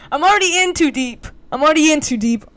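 high, loud, exasperated exclamation; frantic outburst. Real, not acted. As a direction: blurt it out, past the point of no return. Panicking over having told the secret.

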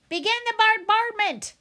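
high, loud battle cry; shouted order. A narrator's reading. Owl commanding the birds to attack the robot, shouted order. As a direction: launch the attack at full voice. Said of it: "ordered Swooper".

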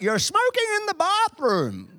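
high mock mum voice catching him out; mum accusing. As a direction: play the mum, shrill-high and knowing, with a laugh.

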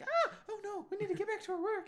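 very high, loud, rushed fluster; hammed-up caught-out panic; mock panic. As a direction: squeal it in exaggerated alarm. Mocking an embarrassed, flustered reaction.

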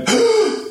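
sudden high gasp for air; mock out-of-breath inhale; demonstrated gasp. Shown as a demonstration. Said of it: "then you stop going".

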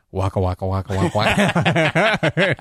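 mock arcade chomping noise; Pac-Man waka sound. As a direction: imitate Pac-Man's waka-waka, breaking into laughter. Mimics Pac-Man noise as a joke.

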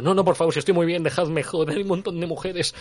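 high, whiny mock protest; mock pleading. Man refusing rescue from the convent, high mock plea. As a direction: beg lightly to be left among the women.

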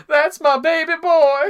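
high, loud, slow proud-dad call; dad shouting from the concert seats, proud; proud dad cheer. Retold or imitated. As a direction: cheer from the stands for your son. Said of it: "My dad would stand up".

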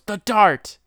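high, loud mock outburst; sudden exclamation. Stabbed man calls out what hit him, mock alarm. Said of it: "he goes".

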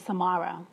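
Samara said as suh-MAH-ruh, quiet; correct pronunciation. Retold or imitated. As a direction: give Samara an open "ah" in the middle, softly. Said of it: "And I said".

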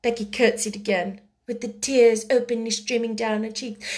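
ordinary delivery, nothing marked

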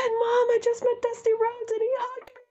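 high, thrilled retelling to mom; excited phone call. Retold or imitated. Telling mom on the phone about meeting Dusty Rhodes, high and thrilled. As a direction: squeal it like you just met your hero. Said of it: "I said".

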